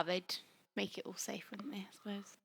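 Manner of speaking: quiet unvoiced aside; hushed, half-joking whisper. Real, not acted. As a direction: drop to a whisper for the joke. Quiet aside about safety.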